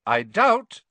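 loud, high, cheerful interjection. A narrator's reading. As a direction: raise the voice cheerfully to cut across them. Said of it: "cheerful but slightly raised voice".

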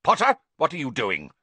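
sharp demand. A narrator's reading. Snape catching Harry eavesdropping, a loud sharp demand.

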